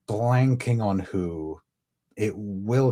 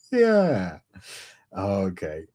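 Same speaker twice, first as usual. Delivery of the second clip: usual speaking voice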